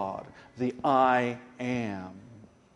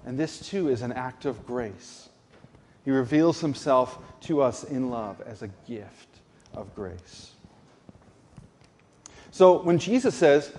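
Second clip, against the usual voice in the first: ordinary talk, nothing marked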